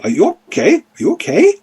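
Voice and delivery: squawky repeated mimicry; mimicking bird-creature. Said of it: "almost like it's mimicking a, another voice".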